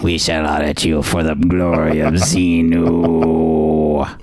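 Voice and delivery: low, slow, ominous mock pronouncement; mock cult leader. Joking about Scientology, slow solemn cult-leader voice. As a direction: intone it like a sinister cult priest.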